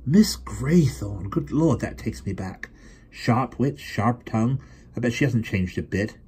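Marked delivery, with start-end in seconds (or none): 0.0-4.6 lightly voiced fond recollection, slow at first; a hint of a nostalgic old friend; character voice. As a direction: savour the name, remembering. Reminiscing character voice.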